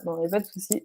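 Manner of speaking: usual speaking voice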